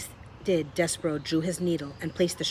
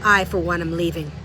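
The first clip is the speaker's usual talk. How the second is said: huffy; 9 dB louder than usual. A narrator's reading. Botticelli storms off.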